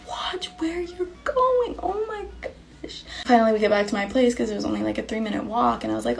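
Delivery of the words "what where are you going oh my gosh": hushed, high, breaking plea; soft mock-tearful squeak; retold heartbroken distress. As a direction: whisper it as if near tears. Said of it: "The way I broke".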